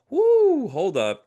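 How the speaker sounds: high, loud drawn-out whoo, then easing down; sudden loud exclamation of alarm, retold; startled whoop. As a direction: stop yourself short with a big whoop. Retells sudden startled realisation.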